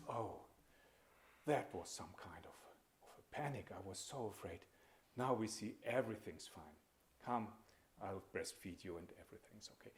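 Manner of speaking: lightly voiced relieved mother, hushed. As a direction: gently comfort the baby after a scare. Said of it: "She is so relieved".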